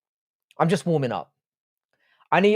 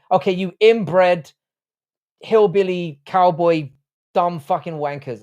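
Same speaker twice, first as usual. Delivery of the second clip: loud, slow, high playful tirade; playful trash talk, loud and deliberate; mock insults; 4 semitones higher, 5 dB louder, slower than usual. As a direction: hurl spaced-out mock abuse, word by word. Mock insults traded with Frankie.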